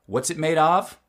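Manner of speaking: OF at the end, full vowel, high and loud; stressed OF. Shown as a demonstration. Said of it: "when it gets a little stressed".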